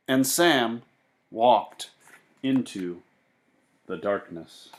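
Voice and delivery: lively read-aloud voice for a class; storybook narration. A narrator's reading. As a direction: read it to the kids, with bounce and wonder. Teacher reads picture book aloud.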